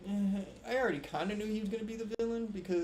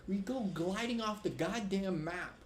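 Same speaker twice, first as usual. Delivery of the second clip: ordinary talk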